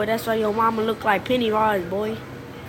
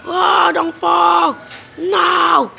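high, loud, stretched-out cry; panicked shout; 6 semitones higher, 6 dB louder, much slower than usual. Real, not acted. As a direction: yell as you slip off the edge. Panicking as character nearly falls.

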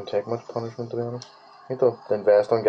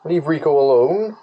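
loud, higher call to the monster; shout; 6 semitones higher, 6 dB louder than usual. Real, not acted. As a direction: yell to protect your buddy. Defending companion in fight.

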